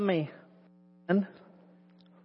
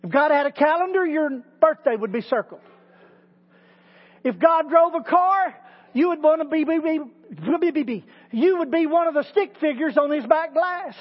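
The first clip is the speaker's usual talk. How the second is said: higher, impassioned preaching; preaching at full force; 6 semitones higher than usual. Acted. Preacher assuring listeners, impassioned preaching. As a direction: preach it with rising conviction.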